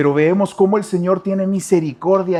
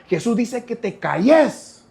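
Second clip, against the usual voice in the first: mock hushing crowd; 8 semitones higher, slower than usual. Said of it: "pudieron haber pensado".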